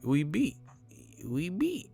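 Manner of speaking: high-pitched dismissive question; Roger Mayweather. Retold or imitated. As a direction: imitate an unimpressed old trainer. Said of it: "Roger Mayweather voice".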